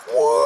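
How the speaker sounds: a short joyful woo; happy woo; excited whoop. Retold or imitated. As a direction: whoop with delight.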